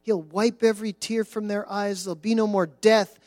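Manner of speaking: solemn, swelling Bible reading over music; scripture reading. Acted. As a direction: proclaim the verses from the pulpit.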